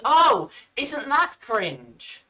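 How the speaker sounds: loud, slow mock "Oh"; mocking imitation. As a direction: parody a smug debunker, drawing it out. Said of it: "and then saying".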